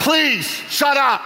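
high, drawn-out comic shout; exasperated mock plea. God pleads with talkative Peter, mock exasperated shout. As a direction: beg loudly, stretching each word.

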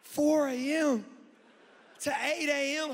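mock-incredulous high holler; inward disbelief. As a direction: drag it out loud, aghast at the hour. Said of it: "But on the inside, I was like".

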